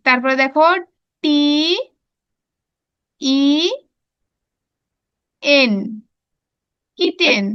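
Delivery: "কে", "আই", "টি" as separate letters, then "কিট"; syllable by syllable. Shown as a demonstration. Teacher showing pupils how to split "kitten", letter by letter. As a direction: spell "kitten" in two chunks with long pauses between letters. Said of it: "syllable ভাগ ভাগ করে উচ্চারণ করব".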